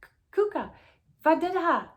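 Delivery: screaming. Acted. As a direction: scream with all your breath from the shelf top. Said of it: "immediately started screaming".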